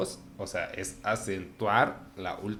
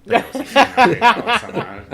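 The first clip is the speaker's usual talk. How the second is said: laughing confession; 16 dB louder than usual. Real, not acted. Confesses habit amid laughter.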